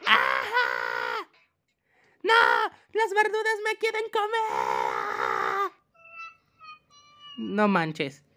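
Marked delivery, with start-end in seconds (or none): 0.1-1.3 long drawn-out game scream. Pretend. Scream as vegetables attack. 2.2-5.7 high, drawn-out mock-terrified shriek; panicked scream. Vegetables attack the player.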